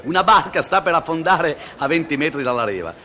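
usual speaking voice

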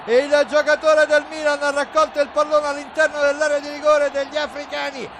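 ordinary delivery

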